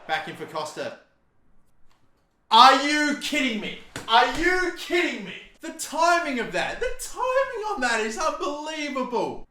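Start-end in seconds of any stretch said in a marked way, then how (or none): 2.5-9.5 high, loud shout of disbelief; outraged shout. Real, not acted. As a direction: yell it out, truly outraged at the goal. Goal conceded, outraged disbelief.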